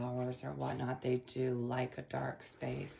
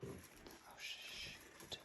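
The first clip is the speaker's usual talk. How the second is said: whispered; 16 dB quieter than usual. Real, not acted. Quiet mishap while tying.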